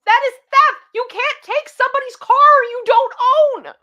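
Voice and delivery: high, loud indignant outburst; yelling in disbelief at the mother; outraged yell. Real, not acted. Reacting to the story, outraged and loud.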